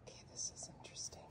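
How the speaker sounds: fast hushed whisper. Real, not acted. Whispering to the camera beside the kittens. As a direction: whisper so the kittens aren't disturbed.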